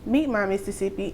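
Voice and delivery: ordinary talk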